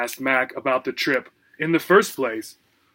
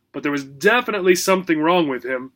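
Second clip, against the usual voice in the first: plain reading voice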